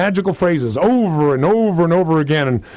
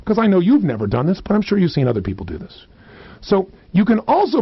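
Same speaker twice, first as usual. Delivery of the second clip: normal talking voice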